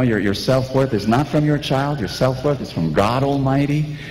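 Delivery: ordinary talk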